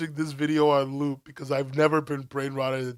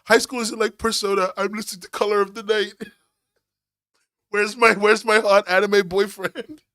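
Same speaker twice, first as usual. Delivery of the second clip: mock-excited impression, louder; hammed-up parody of her video, a bit higher; imitating a video creator; 5 semitones higher, 6 dB louder than usual. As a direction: playfully mimic her, pushed loud. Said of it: "I just love the way she makes videos. Like".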